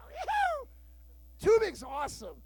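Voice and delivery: usual speaking voice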